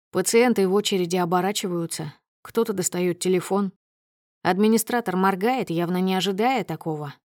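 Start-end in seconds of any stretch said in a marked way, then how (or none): none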